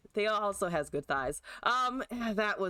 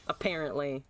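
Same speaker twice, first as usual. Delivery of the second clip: ordinary delivery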